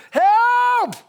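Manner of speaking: loud, high hollered prayer; imitated yell for help; cry for help. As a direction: belt out the one word. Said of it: "there's one prayer I pray".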